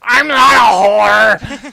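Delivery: high, slow, outraged shout; indignant yell. Acted. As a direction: bellow it, deeply offended. Offended NPC yells back.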